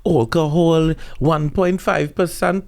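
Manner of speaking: high, put-on alarmed voice; mock-shocked Trinidadian. Trinidadian comedian mocking worry over children's weak beer, mock-shocked. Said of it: "No one in Trinidad's gonna be like".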